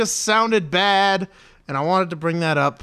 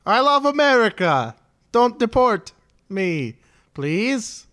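higher, pleading foreign voice; mock immigrant voice; 5 semitones higher than usual. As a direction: beg in a put-on accent. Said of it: "As our resident ethnic".

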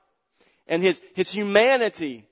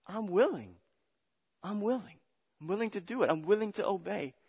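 softer, tender words of surrender; soft prayer; 5 semitones higher, 7 dB quieter than usual. Acted. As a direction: whisper your yes to God.

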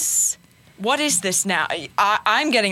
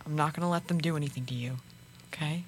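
whisper; 6 semitones lower, 13 dB quieter, faster than usual. Acted. Whispering secretly to the child.

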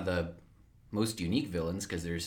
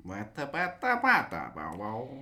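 Greedo alien gibberish; slower than usual. Retold or imitated. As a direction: babble like Greedo.

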